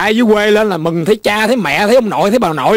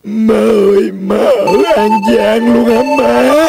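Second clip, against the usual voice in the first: hammed-up crying; tearful wail; 3 semitones higher than usual. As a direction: wail to mother through mock tears. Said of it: "sao mà cậu khóc dữ vậy".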